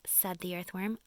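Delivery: plain reading voice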